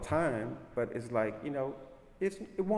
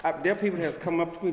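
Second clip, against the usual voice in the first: ordinary talk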